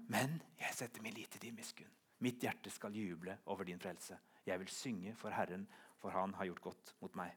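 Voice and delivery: soft, low, intimate recitation; hushed psalm reading. Acted. Preacher repeating the psalm out of the deepest abyss, hushed. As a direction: bring it right down, almost to yourself.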